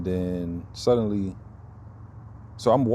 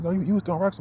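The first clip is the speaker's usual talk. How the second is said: high accusing woman's voice, far higher; mocking accuser; 10 semitones higher, faster than usual. Imitating the woman who accused him, high and sharp. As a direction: squeak out her accusation.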